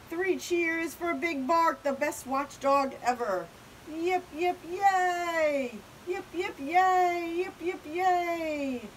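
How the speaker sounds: high, rousing hip-hooray cheer; celebratory cheering. Acted. Crowd honouring their watchdog, cheering. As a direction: lead three cheers with stretched hoorays.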